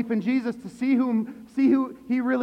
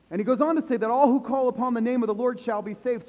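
normal talking voice

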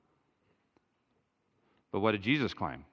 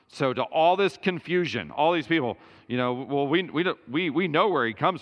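rising sermon fervour; 5 semitones higher, 4 dB louder, faster than usual. Acted. Preacher to congregation, rising higher and louder.

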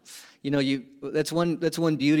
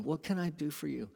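much softer, tender question; gentle Jesus; 10 dB quieter than usual. Retold or imitated. Jesus gently asking the blind man, soft and tender. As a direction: offer help kindly, quietly.

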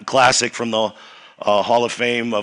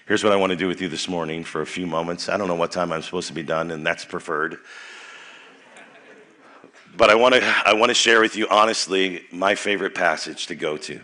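normal talking voice

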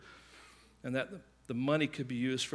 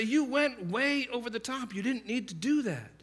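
berating; 8 semitones higher, 3 dB louder than usual. Retold or imitated. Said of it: "they begin to berate you".

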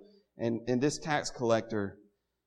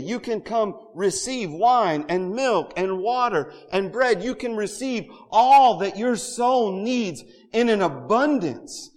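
louder, higher, driving sermon cadence; full-force preaching; 7 semitones higher, 6 dB louder than usual. Acted. Pastor urging the congregation, preaching at full force. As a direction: preach the abundance with rising fervor.

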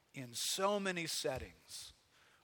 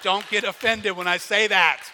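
loud, high, teasing shout; playful shout; 4 semitones higher, 14 dB louder than usual. Real, not acted. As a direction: call it out with a grin to the crowd. Joking warning to congregation.

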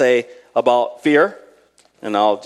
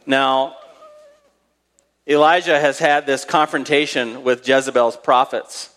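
normal talking voice

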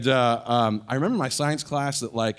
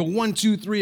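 nervous counting; 9 semitones higher, 4 dB louder, slower than usual. Retold or imitated. Counting before running to parents.

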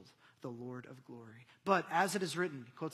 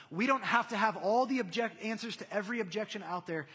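raised, driving sermon voice; higher, emphatic pulpit build; impassioned preaching; 4 semitones higher, 6 dB louder than usual. Real, not acted. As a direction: preach it with force, hammering each repeated phrase. Preaches at full force.

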